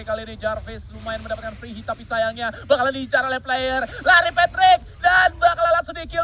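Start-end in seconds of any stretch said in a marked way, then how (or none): 4.0-6.2 excited shout. Real, not acted. Big play in live casting.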